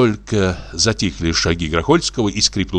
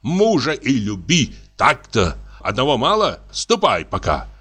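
angry; 7 semitones higher than usual. A narrator's reading. Husband rants at wife.

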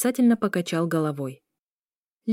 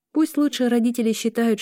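usual speaking voice

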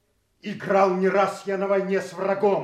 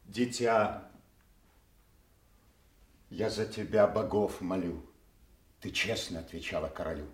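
somber farewell; 12 semitones lower, 7 dB quieter than usual. Acted. Banished Kent says farewell.

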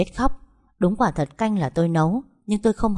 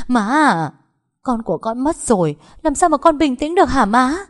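high, loud, anguished outcry; distraught protest; 8 semitones higher, 6 dB louder than usual. Acted. Grieving daughter to mother after losing her baby, distraught. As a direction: cry out in grief, pitch climbing.

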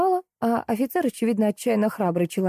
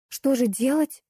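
higher-pitched, lost question; bewildered; 7 semitones higher than usual. A narrator's reading. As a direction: ask helplessly, at a loss. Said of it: "растерялась я". (растерянно)

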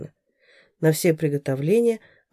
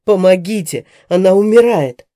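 louder, out-of-breath shout for help; shouting with all his might; 10 dB louder than usual. A narrator's reading. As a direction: scream for help, gasping. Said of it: "крикнул изо всех сил".

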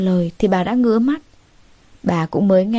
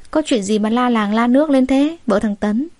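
plain reading voice

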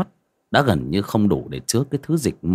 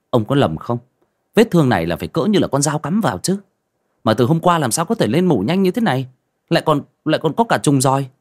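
astonished doctor; 5 semitones higher, 4 dB louder, faster than usual. A narrator's reading. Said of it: "Bác sĩ Nam ngạc nhiên".

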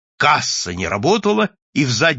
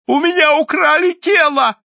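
high, loud panicked yell; the invisible man shouting at the boss; desperate shout; 8 dB louder than usual. A narrator's reading. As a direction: cry out in disbelief. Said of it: "закричал невидимый".

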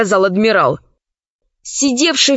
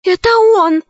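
high, louder startled cry; gasp of recognition; 8 semitones higher, 3 dB louder than usual. A narrator's reading. Zauri recognising her master on screen, startled. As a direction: gasp in shock. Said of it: "ахнула Заури".

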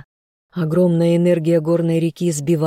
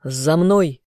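command. A narrator's reading. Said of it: "отдал команду я".

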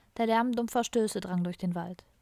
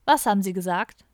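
louder, demanding question; sharp challenge; 8 dB louder than usual. A narrator's reading. Policeman challenges the muttering prisoner, loud and stern. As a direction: demand an answer, raising the volume.

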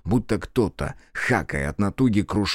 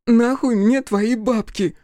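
much higher, slow, louder cry; grief-stricken scream; 13 semitones higher, 4 dB louder, slower than usual. Acted. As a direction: scream it through tears.

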